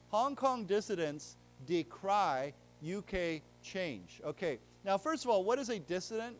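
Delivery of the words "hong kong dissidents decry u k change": Hong Kong dissidents decry U K change read slowly and clearly; slow headline read. Shown as a demonstration. Teacher reading a headline to students, slowly. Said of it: "It says".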